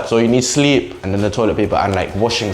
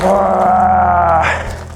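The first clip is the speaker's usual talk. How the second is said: long high cry of effort; straining yell; 6 semitones higher than usual. Real, not acted. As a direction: strain hard and let it out as a cry. Tearing cling film with fingers.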